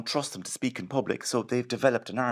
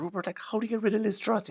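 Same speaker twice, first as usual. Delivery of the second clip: higher, fast pleading question on the phone; a higher-voiced mock plea; 11 semitones higher than usual. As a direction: pitch it up and plead down the line. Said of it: "I said".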